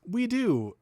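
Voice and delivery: loud, high exclamation; emphatic agreement. Real, not acted. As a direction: pitch it way up and loud. Emphatic agreement with own remark.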